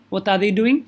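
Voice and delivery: ordinary delivery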